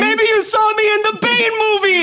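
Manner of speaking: loud, high mock New York tough-guy accent; Tom Hardy New York accent. Friend mocks Tom Hardy's Venom role in a loud New York accent.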